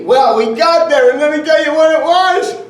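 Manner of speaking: rising incredulous storyteller; mounting disbelief. Retold or imitated. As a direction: build it up like a punchline. The build-up to the big reveal.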